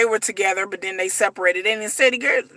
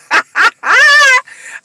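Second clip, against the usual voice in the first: high, loud burst of real laughter; cracking up at the show; loud shrieking laugh; 13 semitones higher, 8 dB louder than usual. Real, not acted. Laughing at the bribe line.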